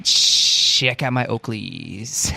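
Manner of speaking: drawn-out, very slow boast; nineties Blake. Acted. Dude showing off Oakleys to a friend, slow drawl. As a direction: play a frosted-tips dude, stretching the words. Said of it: "I'm Blake".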